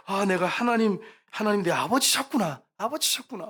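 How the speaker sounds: high, loud retold outburst of happiness; overjoyed realization. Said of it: "막 그러면서 너무너무 행복했답니다". (벅찬 기쁨으로 높고 크게)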